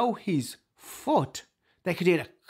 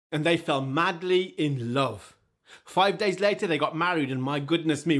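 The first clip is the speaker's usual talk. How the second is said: ordinary delivery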